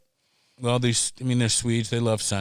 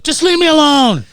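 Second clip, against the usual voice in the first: much higher, much louder cry; mock yell; 13 semitones higher, 10 dB louder than usual. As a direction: yell it while fleeing the cops. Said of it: "I was like".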